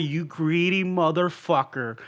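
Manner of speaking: slow, softened insult; imitated insult. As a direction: say it quietly, one word at a time. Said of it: "when you call me".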